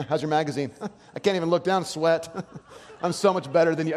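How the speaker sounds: gloating with laughter; mock gloating. Mocking gym rival.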